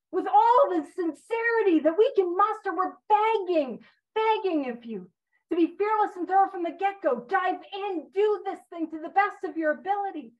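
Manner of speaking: impassioned high, loud exhortation; urgent begging, drawing out the key words; earnest pleading. Acted. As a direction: plead with them emphatically to commit. Paraphrases the book's earnest plea.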